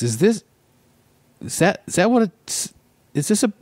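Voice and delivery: puzzled driver staring at the pet hotel; Ghanaian driver voice. Retold or imitated. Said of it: "and he goes".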